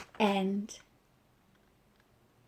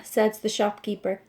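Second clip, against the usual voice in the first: ordinary delivery, nothing marked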